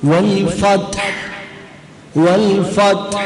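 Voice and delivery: "വൽ ഫത്ഹ്" slow, t closed, breathed h; sukun on t. Shown as a demonstration. Teacher models "വൽ ഫത്ഹ്" for repeating, slow with vowelless t. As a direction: say "വൽ ഫത്ഹ്" slowly as fat-h. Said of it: "ത് എന്ന് സുക്കൂനാണ്".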